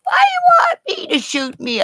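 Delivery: mock outrage at the dictation; mock angry shout. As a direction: yell it at the screen. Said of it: "right away you're going".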